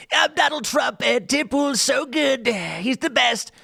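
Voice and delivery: slow, high, exaggerated politician's praise; Donald Trump. Shown as a demonstration. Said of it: "it's not a good Trump impersonation".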